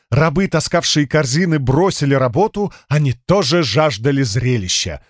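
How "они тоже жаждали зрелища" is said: ordinary delivery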